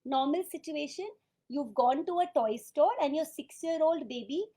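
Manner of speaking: ordinary talk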